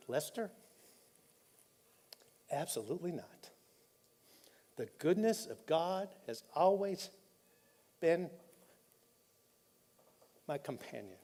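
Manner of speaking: real tears softening the voice, pausing to get it out; choked up. Real, not acted. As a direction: hold back tears as you say it. Choked-up retelling of faith answer.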